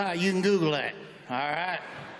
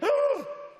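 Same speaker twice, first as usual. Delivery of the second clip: high, exaggerated startled gasp; mock shocked gasp. Waitress startled by a customer's face, high mock gasp.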